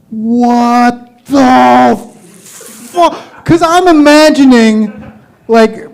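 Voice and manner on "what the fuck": drawn-out slow-mo voice; slow motion. Shown as a demonstration. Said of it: "this is me in slow-mo".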